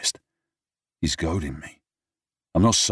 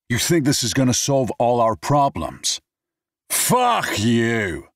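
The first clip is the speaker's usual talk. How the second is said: put-on drunken shout; 8 semitones higher, 5 dB louder, slower than usual. Drunk quarrel with Brad.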